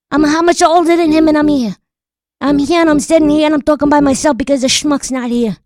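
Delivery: angry rant. Acted. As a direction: yell at the absent grandson. Ranting about late grandson.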